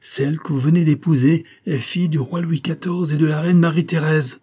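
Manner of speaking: high, sustained proclamation; ringing voice. A narrator's reading. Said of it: "d'une voix éclatante".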